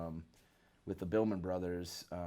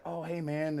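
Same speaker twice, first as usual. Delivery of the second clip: higher, casual greeting; imitating Jeff, higher; 8 semitones higher than usual. Jeff greeting the bassist, lightly voiced higher. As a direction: toss off a friendly hello. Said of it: "And he's like".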